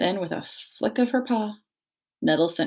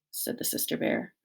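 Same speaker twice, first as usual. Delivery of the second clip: ordinary delivery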